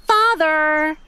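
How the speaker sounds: high whiny Father!; whining. A narrator's reading. Daughter whining at her father, high and whiny. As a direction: whine like a spoiled child. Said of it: "she whined".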